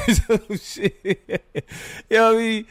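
high, words breaking into real laughter; laughing. Real, not acted. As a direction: crack up while talking, pitch rising. Cracks up at own aside.

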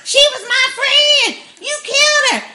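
high mock scream; high screaming at Carol over the body; screaming Lizzie. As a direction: holler it like a hysterical child. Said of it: "screaming and hollering".

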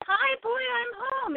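high sing-song hello to a pet; sweet greeting. Retold or imitated. Owner greeting her pet bird in a high coo.

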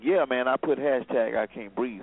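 mocking voice. Mocking a hashtag supporter.

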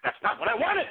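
high, loud indignant outburst; sudden outburst. Acted. Impersonator erupting to Joyce about his stolen act. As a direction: suddenly flare up in protest.

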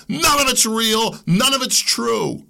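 slow, loud, high "None" each time; emphatic stress. Real, not acted. Host declaring everything fake, punching none.

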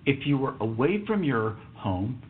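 normal talking voice

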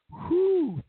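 high exaggerated whew; mock relief. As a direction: sigh out fake relief.

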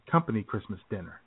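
ordinary talk, nothing marked

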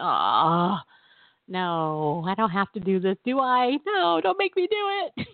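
hammed-up reluctant whine; mock dread. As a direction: plead like a reluctant kid, half joking. Acts out dreading the task.

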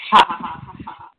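loud burst of laughter that drops away; a hearty real laugh that trails off. Real, not acted. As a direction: laugh out loud, then fade. Host laughs at the story.

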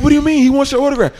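scolding overprotective mom. Retold or imitated. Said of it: "She's like".

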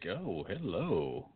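slow, soft, awestruck hello; in awe. Retold or imitated. Recalling a new coworker's first day, slow quiet awe. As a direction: draw it out, quietly dazzled. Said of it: "In awe".

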